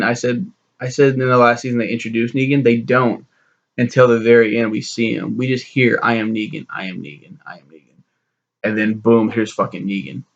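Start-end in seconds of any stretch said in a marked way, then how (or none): 6.0-8.0 each repeat dying away into the distance; fading echo. Retold or imitated. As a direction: let the name echo and fade out. Voice heard calling from offscreen.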